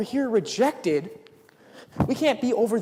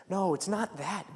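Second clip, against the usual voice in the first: ordinary delivery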